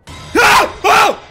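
startled shout. Real, not acted. Jump scare in game.

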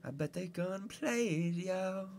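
slow, high put-on jeer; mocking heckle. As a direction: drag out the taunt in a high voice. Mocking challenge of friend's watch.

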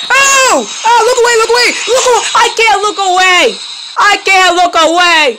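high, loud terrified shouting; panicked scream. Real, not acted. Player trapped by ghost, screaming in panic. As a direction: yell in panic, unable to look away. Slendrina attack again.

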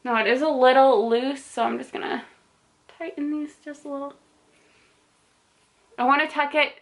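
speaking behind cloth mask; muffled by fabric covering; through a face mask. Real, not acted. Seen 0: Blue fabric mask covers mouth and nose throughout labelled words.

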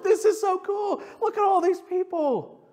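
imitating Josh in awe; excited. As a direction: marvel out loud. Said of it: "he just was like".

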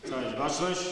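loud parade-ground command; drill sergeant. Real, not acted. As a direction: call the whole hall to attention. School assembly, commands called out.

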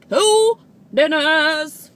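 slow, loud exclaimed punchline; emphatic exclaim. Real, not acted. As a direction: drag it out loud and proud. Punchline on two dinners.

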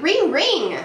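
high, lilting phone ring; ringing imitation. Shown as a demonstration. Teacher asks what makes this sound, ringing imitation. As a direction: ring out like a telephone. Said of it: "What makes that sound".

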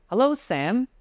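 high, bright and cheery hello; wife greeting the grocer, falsely cheerful. Acted. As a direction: greet him like nothing is wrong. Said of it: "she said brightly".

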